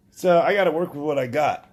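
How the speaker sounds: usual speaking voice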